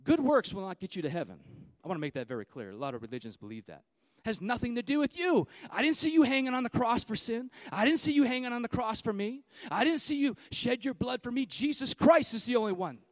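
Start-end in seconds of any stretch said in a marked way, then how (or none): 4.2-13.0 fiery sermon peak; preaching at full force. Acted. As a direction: thunder it out to the congregation.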